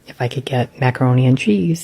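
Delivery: ordinary talk, nothing marked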